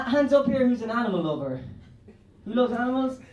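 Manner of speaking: usual speaking voice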